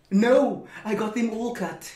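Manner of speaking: ordinary talk, nothing marked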